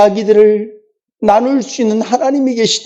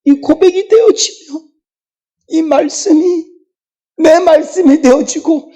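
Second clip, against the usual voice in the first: rising preaching climax; 7 semitones higher than usual. Real, not acted. Pastor leading the church's confession of faith, building to a fervent higher climax. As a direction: build the confession to a ringing peak.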